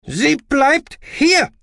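high, loud, drawn-out shout; slow, strident yell; screamed. Acted. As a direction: scream each word in refusal. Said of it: "schrie Aspartamin".

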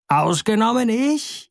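a high, small shout of triumph; tiny bird's shout. A narrator's reading. The little nameless bird shouts up at the eagle, high and cocky. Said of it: "schrie der kleine Kerl ohne Namen".

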